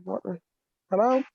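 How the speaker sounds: ordinary talk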